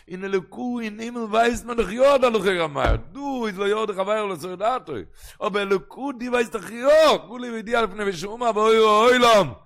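high singsong building to a loud cry; chanted, climbing, ringing exclamation; impassioned preaching. Real, not acted. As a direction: drive the argument up to full force. Preaching builds to full force.

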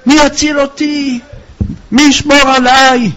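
high-pitched mourner's lament, stammering midway; acted despairing wail at a funeral; anguished wailing cries. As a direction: cry out as if bereft of your protector. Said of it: "זה צעק צעקות איומות".

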